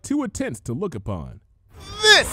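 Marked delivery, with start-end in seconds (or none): 2.0-2.3 very high, loud climactic shout; full-force battle cry; triumphant shout. A narrator's reading. Rainbow Dash striking the changeling, shouting.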